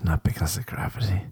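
breathy whispered aside. Acted. Comic whispering the gravity punchline to the audience.

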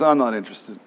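low, deflated refusal; dejected pig. Acted. Pig turning down the chicken's idea, dejected. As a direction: let the voice sink in mild disappointment. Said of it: "The pig kind of head falls down".

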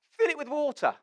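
high, loud confident order; cocksure. Acted. Elijah raising the stakes of the contest, cocksure. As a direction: order it loudly, as a dare. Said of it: "The confidence of the man".

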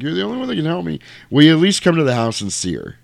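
high-pitched imitation of crying pleading; mock tearful plea. Crying mother begging the priest for help, mock tearful plea. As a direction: plead like a mother in tears, hammed-up. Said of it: "she starts crying".

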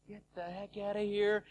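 hushed mock alarm; startled aside. Friends on a bench reacting to screams, in hushed alarm. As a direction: say it under your breath, as if spooked.

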